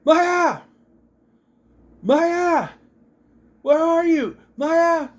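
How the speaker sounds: high, loud calling out for someone lost; mock yelling. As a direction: imitate his frantic yell. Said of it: "he starts yelling".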